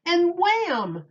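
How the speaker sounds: high, punchy burst; sudden wham. Acted. Reader to children on a mixed-up day, punchy surprise. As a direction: pop it out like a surprise.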